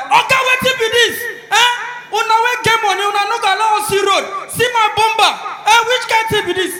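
high, loud indignant ranting; acted road-crash fury; angry outburst. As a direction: yell at the driver who hit you. Acted angry driver after a crash.

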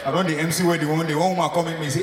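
normal talking voice

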